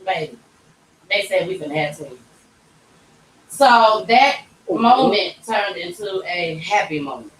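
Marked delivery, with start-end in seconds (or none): 0.0-2.2 lower, softer, fast news to husband; wife breaking twins news to husband, soft; soft telling. Retold or imitated. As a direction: tell him gently and quickly. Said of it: "I'm like".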